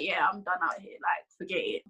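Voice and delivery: a hushed, giving-up whisper; defeated whisper. Retold or imitated. Someone who has written themselves off, whispering in defeat. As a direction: breathe it out like you've quit. Defeated self-talk after the enemy's accusations.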